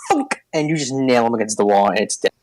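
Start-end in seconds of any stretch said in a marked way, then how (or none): 0.1-0.4 high squeaky dying splat; creature death squeal. Acted. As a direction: squeak it like a squashed monster. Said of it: "the Intellect Devourer goes".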